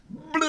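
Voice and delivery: high mock-disgusted "blah"; kid's yuck at lovey-dovey stuff. A narrator's reading. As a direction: spit out a grossed-out blah. Children disgusted at romance.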